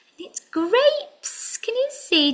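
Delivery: squeaky little puppet friend; high puppet voice. Pretend. Puppet character speaks to children.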